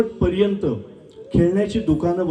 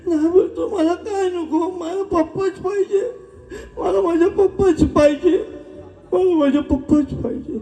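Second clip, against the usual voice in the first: put-on sobbing lost child; 12 semitones higher than usual. Lost boy turns down sweets wanting only Papa, wailing. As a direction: cry out desperately for Papa in a child's voice.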